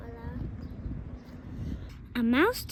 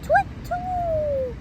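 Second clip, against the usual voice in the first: high hooting twit-twoo; shrieking owl call as it flies off; 14 semitones higher, 9 dB louder than usual. Acted. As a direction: hoot like a scared owl.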